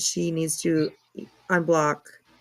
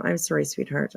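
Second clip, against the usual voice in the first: ordinary delivery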